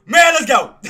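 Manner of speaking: loud, high hype shout; excited cheer. Real, not acted. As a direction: celebrate the win at full volume. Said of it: "I'm just excited".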